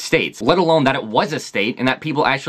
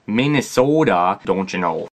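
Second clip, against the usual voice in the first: lower, mock Minnesotan accent tag; 6 semitones lower, slower than usual. Mocked by outsiders, a lower Minnesotan accent. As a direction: toss off don't you know in a Minnesotan accent. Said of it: "everyone makes fun of them".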